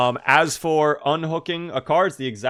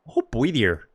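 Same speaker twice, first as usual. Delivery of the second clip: trying on a Canadian accent; put-on Canadian character accent; slower than usual. Shown as a demonstration. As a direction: attempt a hammy Canadian accent. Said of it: "how good is your Canadian accent".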